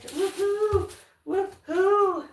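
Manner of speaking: high excited whoops; cheering. Real, not acted. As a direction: cheer out loud with joy. Cheering for the paint.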